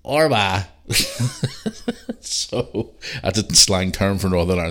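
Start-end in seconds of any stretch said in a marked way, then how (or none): 0.1-0.7 Belfast slang, snarky and clipped short; a cheeky Belfast "Orwa" with a dropped t; snarky Belfast accent. Retold or imitated. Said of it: "snarky Belfast accent and go". 0.9-2.1 laughing. Real, not acted. Laughs after the impression.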